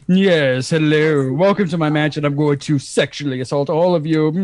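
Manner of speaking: Frank-N-Furter. Acted. Mansion host greeting his guests, campy low voice. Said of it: "Frank-N-Furter".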